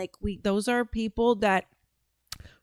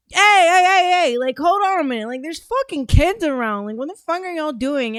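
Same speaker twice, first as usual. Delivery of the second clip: high, loud yelling to break up a fight; retold shout; 6 semitones higher, 4 dB louder than usual. Breaking up a Walmart fight between two big dudes, loud shout. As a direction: shout them down, hey hey hey. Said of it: "And I'm just like".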